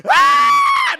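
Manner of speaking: very high, loud crowd yelp ah; crowd shout. Retold or imitated. Crowd reacts to a dodged chicken wing, high loud yelp.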